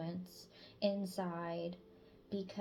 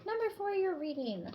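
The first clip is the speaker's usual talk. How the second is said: excited announcement; 11 semitones higher, 4 dB louder than usual. Real, not acted. Announcing next reading.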